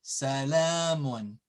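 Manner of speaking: salamun, slow with a long la; long vowel. Shown as a demonstration. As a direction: stretch the middle a of salamun. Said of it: "See the alif".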